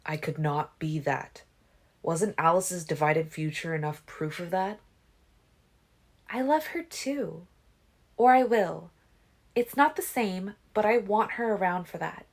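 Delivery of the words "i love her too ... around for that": put-on higher, earnest young woman; sincere. A narrator's reading. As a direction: say it from the heart. Alice speaks of loving Bella.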